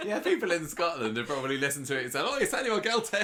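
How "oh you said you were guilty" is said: high, loud mock astonishment; mock exclamation. People in Scotland mishearing the plea, astonished. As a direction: pipe up, thrilled to have misheard. Imagines Scottish listeners' excited reaction.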